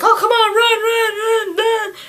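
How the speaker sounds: very high, squealing urgency; play-acted frantic cry; mock panic. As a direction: urge everyone to flee in hammed-up panic. Acted panicked urging to flee.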